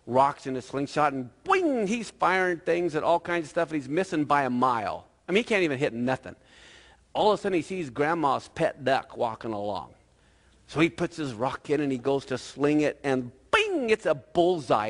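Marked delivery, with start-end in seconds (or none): 1.1-1.9 high, twanging "boing"; cartoonish boing; springy slingshot boing. Slingshot sound in story. 13.5-13.9 high, ringing "bing"; cartoonish bing; slingshot bing. Slingshot sound in story.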